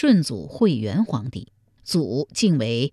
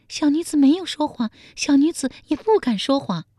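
much higher, fast frightened protest; pale frightened maid; 11 semitones higher, faster than usual. A narrator's reading. The terrified maid insists to the emperor she is not lying, high and hurried. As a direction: plead your honesty in a rush of fear. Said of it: "宫女面如涂蜡说".

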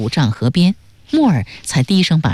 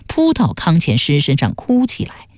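ordinary delivery, nothing marked

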